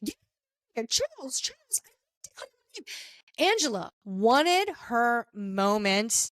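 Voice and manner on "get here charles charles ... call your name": high wife voice. Retold or imitated. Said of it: "something to that effect".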